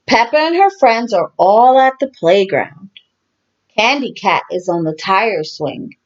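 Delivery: ordinary delivery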